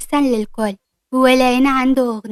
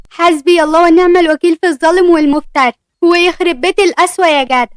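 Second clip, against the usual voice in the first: higher, louder dramatic complaint; mock lament; 4 semitones higher, 5 dB louder than usual. Ward complaining to Youssef about the refused money, dramatic. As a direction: wail like a wronged victim.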